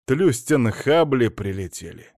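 usual speaking voice

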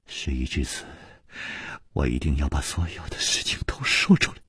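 low, hushed, sorrowful voice; mournful resolve. A narrator's reading. Liu insisting on a full confession, mournful. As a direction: resign yourself softly to telling all. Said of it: "柳无眉凄然说".

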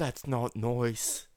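slow mock-Australian accent. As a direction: drawl it in an exaggerated Australian accent. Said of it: "It's talking in Australian accent".

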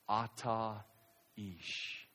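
very soft, low Hebrew "Ata ish"; solemn verdict. Retold or imitated. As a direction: deliver the accusation hushed.

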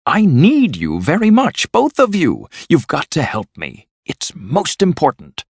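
high, insistent pleading; urgent plea. A narrator's reading. Toad begs both friends for help, urgent and high-pitched. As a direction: press them eagerly for help.

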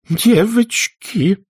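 put-on Aunt Pitty wailing at the quarrelling girls; wailing plea. A narrator's reading. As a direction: wail it, trembling. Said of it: "взмолилась мисс Питтипэт".

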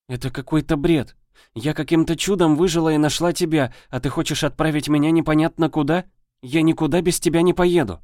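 put-on high, upset girl's protest; a girl stamping her foot; indignant girl. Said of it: "заявила Аннели и упёрла руки в бока".

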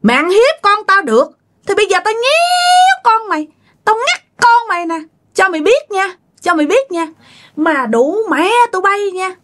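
high-pitched, scolding threat; angry threats. Retold or imitated. Vengeful mother warns her child's bullies, high-pitched and threatening.

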